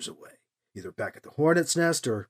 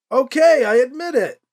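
higher, louder yelled admission; yelling; 9 semitones higher, 7 dB louder than usual. A narrator's reading. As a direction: yell it down the hill. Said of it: "Alibi yelled".

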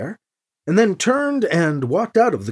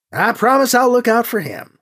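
higher, lightly voiced pilot; Gentry's promise; 6 semitones higher, 3 dB louder than usual. A narrator's reading. Gentry echoes the promise to Anita, lightly voiced, higher. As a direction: echo the pledge in a higher voice. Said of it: "Gentry said".

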